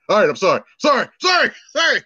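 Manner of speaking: mock-panicked child begging off; acted scared kid, pleading; panicked apology. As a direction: blurt repeated apologies, jumping high and loud. Child panicking at threat of dad.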